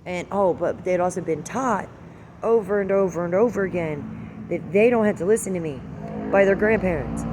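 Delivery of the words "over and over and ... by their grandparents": ordinary delivery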